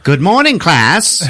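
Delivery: high, loud sing-out greeting; mock teacher greeting. Teacher walking in to her class, loud mock greeting. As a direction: call it out high as a schoolteacher.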